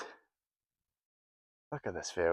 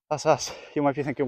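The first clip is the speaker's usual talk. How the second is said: ordinary talk, nothing marked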